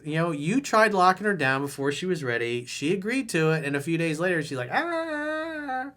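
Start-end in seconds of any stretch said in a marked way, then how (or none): 4.4-5.9 put-on high startled "ah" of a girlfriend panicking; freaked-out gasp. Girlfriend freaking out about commitment, high panicked gasp. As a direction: gasp like she just got spooked. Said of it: "She's freaking out about it".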